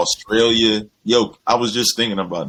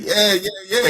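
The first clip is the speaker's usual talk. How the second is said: higher, eager yeahs; eager kids; 12 semitones higher than usual. Retold or imitated. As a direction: nod it off quick and pitched up. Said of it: "He was like".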